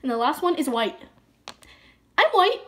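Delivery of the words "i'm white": playful quip. Real, not acted. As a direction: toss off a cheeky joke.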